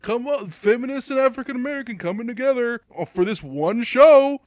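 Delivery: high, loud hammed-up barker; loud mock invitation, building to a big finish; mock pandering. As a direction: herd the crowd in with fake excitement. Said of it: "Not just like".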